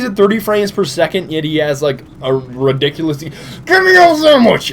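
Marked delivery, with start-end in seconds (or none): 3.2-4.7 very high, loud, drawn-out goofy yell; comic shriek of a demand; mock demanding shout. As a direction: scream it slowly as a silly catchphrase. Shouted catchphrase during game kill.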